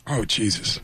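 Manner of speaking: low, muttered disgust; Al Pacino impression. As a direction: mutter it under the breath, deep and weary. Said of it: "the Al Pacino looked at him and went".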